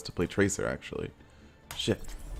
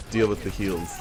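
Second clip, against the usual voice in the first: usual speaking voice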